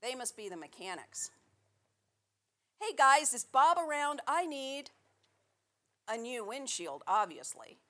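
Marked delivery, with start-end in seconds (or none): 2.8-4.9 calling out. Acted. Calling out to mechanics.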